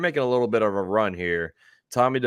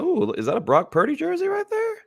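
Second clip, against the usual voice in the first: much higher, delighted surprise; surprised. Real, not acted. Host spotting a viewer's Brock Purdy jersey, surprised squeal. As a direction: catch sight of it and squeal.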